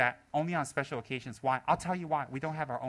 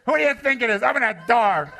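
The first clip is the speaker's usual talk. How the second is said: loud, exasperated order; mock impatient demand; 6 semitones higher, 10 dB louder than usual. Rinpoche demands the door be opened, loud and exasperated. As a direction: demand entry, fed up.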